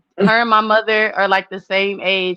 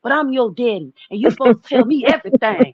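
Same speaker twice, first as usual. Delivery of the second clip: lightly voiced Chicago dad, pitched up and insistent; a hint of a Chicago father demanding to know everything; Chicago dad impression; 4 semitones higher, faster than usual. Daughter imitates her Chicago dad demanding answers, lightly accented. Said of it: "so he talks like this".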